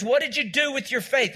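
normal talking voice

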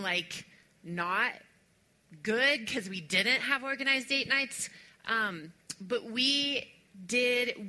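normal talking voice

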